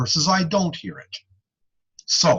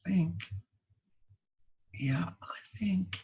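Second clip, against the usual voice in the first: unsure whisper; 15 dB quieter than usual. Acted. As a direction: whisper it like you're doubting yourself. Acting unsure client straining to hear.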